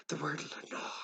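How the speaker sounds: soft, high echoing murmur; faint ghostly echo of the word. A narrator's reading. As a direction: let it come back faint and distant like an echo. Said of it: "an echo murmured back".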